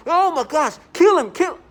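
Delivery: high, loud imitated shriek; mock screaming. Imitating neighbours screaming through the door. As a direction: screech the taunt. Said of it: "they force you to think in this way".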